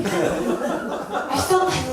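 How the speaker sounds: ordinary talk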